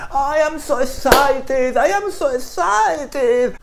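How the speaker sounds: high, slow, bubbly American enthusiasm; gushing American. Retold or imitated. Spanish teacher mocking American tourists, high and gushing. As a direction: mimic an overexcited American. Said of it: "el camarero o la camarera te dice".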